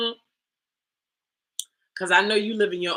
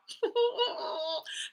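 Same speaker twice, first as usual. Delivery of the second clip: high laugh; 8 dB quieter than usual. Real, not acted. Laughing after the imitation.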